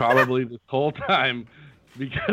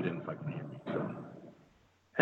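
hushed whispered explanation; 9 semitones lower, 12 dB quieter, much slower than usual. Real, not acted. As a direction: whisper it quickly. Talking while unsure if muted.

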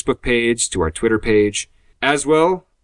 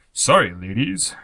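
slow, drawn-out ad-man voice; Old Spice pitchman, unhurried; slower than usual. Pretend. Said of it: "do that in the Old, Old Spice voice".

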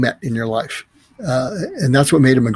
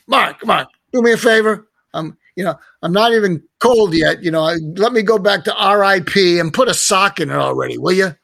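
higher, put-on Brooklyn accent of an old talk-show host; old Brooklyn guy scolding a friend in a higher Brooklyn accent; Larry King Brooklyn voice; 6 semitones higher than usual. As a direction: do a raspy-free, high Brooklyn accent, teasing a friend. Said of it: "in his Brooklyn voice".

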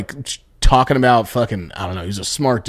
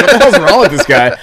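louder, higher, laughing through it; laughing disbelief; 6 semitones higher, 7 dB louder than usual. Retold or imitated. Teller laughs retelling his baffled reaction to the old man, louder and higher. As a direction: crack up in disbelief.

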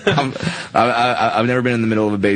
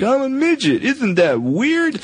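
high, slow goofy stage voice; high silly voice; slower than usual. Retold or imitated. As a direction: ham it up, high and drawn out. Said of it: "going up there just being like".

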